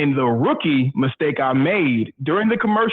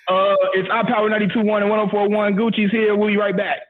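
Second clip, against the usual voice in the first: raised-pitch on-air DJ voice; retold radio sign-off, pitched up and even; hurried radio station ident; 6 semitones higher than usual. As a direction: rattle off a station break as if covering a walkout. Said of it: "And I'm like".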